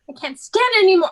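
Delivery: high, loud and fast, at the end of her rope; desperate outburst. Acted. Irina cries out her despair to her sisters, high and loud. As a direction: blurt it out, rushing and breaking.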